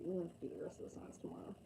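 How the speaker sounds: fast hushed whisper; quick whispered aside. Real, not acted. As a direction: slip it out under your breath, quickly. Late night aside to viewers.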